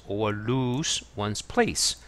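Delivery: words stretched for learners; slow reading. Shown as a demonstration. Teacher rereads the definition for Korean learners, very slowly. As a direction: read it very slowly, word by word. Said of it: "teacher rereads definition".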